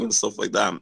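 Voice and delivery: ordinary delivery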